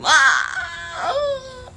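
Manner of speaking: high, strained cry of exasperation; frustrated scream. Real, not acted. Fed up with viewers who see no problem, screaming. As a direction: let out a wailing "ah".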